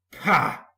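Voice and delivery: loud scornful snort; scornful pah. A narrator's reading. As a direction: scoff in disgust. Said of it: "said Gordon crossly".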